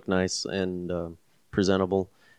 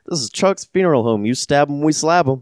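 higher, louder hammed-up jingle voice; cheesy funeral ad; 6 semitones higher, 5 dB louder than usual. Joking guest hams up a tacky funeral home slogan. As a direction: hype it like a cheap local ad. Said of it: "someone saying".

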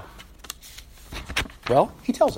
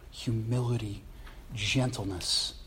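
Humility, gentleness said lower and much softer, gentleness slowed; hushed repeat; 6 semitones lower, 8 dB quieter than usual. Shown as a demonstration. Preacher repeats the virtues to the church, softly and slowly. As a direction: drop to a soft hush on Humility, gentleness. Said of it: "repeated list, now hushed".

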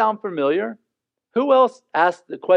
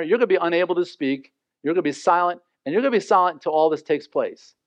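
normal talking voice

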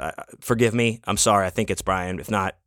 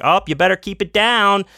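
high, loud sarcastic announcement; taunting yell from the stage; mock yelling; 10 semitones higher, 4 dB louder than usual. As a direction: call it out mockingly to everyone. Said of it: "I was on the stage yelling".

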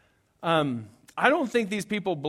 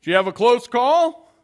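gas attendant; 7 semitones higher, 11 dB louder than usual. Retold or imitated. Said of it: "the guy was like".

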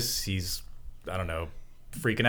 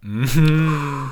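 agreeing mm-hm; 5 semitones higher, 6 dB louder than usual. Real, not acted. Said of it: "the way that [name] says, "Mm-hmm".

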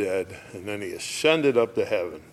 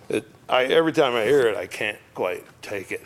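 normal talking voice

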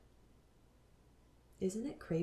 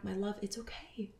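soft, half-whispered and higher, tender; calling herself back; 5 semitones higher, 3 dB quieter than usual. Retold or imitated. To herself lost in spirit world, whispered tender reassurance. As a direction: hush and soothe a frightened part of yourself. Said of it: "I would just call myself back".

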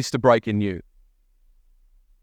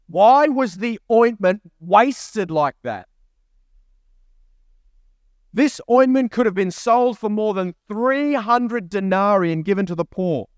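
a slow, indignant scolding, raised pitch; indignant critics; 5 semitones higher than usual. Retold or imitated. A preacher voicing critics, indignant at wasted ointment. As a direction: play the offended onlookers who judge her. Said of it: "some who said to themselves indignantly".

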